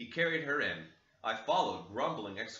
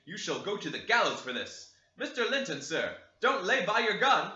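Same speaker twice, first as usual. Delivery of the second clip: loud, higher servant's voice; servant reporting; 5 semitones higher, 5 dB louder than usual. Acted. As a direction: report it eagerly to the master. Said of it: "he added".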